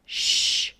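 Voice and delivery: hushing sound; a breathy "shh" for silence; sharp shush. A narrator's reading. Giver hushing the boy at the screen, shushing. Giver hushes Jonas while watching.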